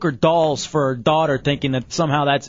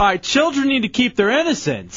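higher, mock-prim sarcastic tone; 7 semitones higher than usual. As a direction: parrot the worried mom sarcastically. Host sarcastically repeating the mom.

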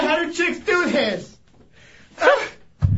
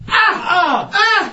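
pained yell; 4 semitones higher, 4 dB louder, much slower than usual. Real, not acted. Waxing strips ripped off.

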